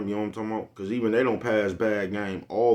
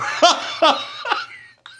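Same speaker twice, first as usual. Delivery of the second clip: high burst of laughter; 8 dB louder than usual. Real, not acted. Cracking up at own joke.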